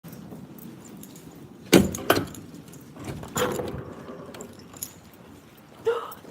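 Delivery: sharp high gasp of surprise; a sudden shocked intake of breath; surprised gasp. Real, not acted. Mom sees snow outside and gasps in surprise. Discovers it is snowing.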